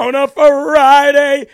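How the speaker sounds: loud, high, slow preacher cry; hammed-up preacher echoing the clip; mock preacher wail. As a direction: belt it like a sermon. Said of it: "mocking the clip again".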